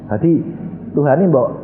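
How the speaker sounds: ordinary delivery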